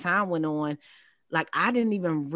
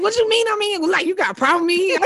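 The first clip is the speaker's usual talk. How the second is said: high, loud, laughing you got a problem; hammed-up challenge, half laughing; playfully squaring up, pitched way up; mock confrontational; 13 semitones higher, 7 dB louder, faster than usual. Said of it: "I come to like".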